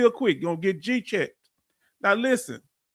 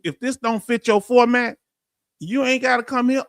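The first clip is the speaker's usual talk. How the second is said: ordinary talk, nothing marked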